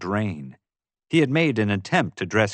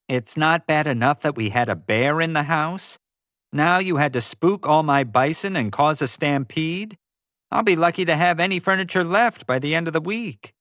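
higher-pitched, put-upon complaint; exasperated scolding; 7 semitones higher than usual. A narrator's reading. Heidi giving J J an earful over the bison stampede, exasperated. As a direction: give him an earful, voice pitched up.

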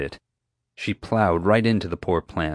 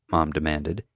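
ordinary delivery

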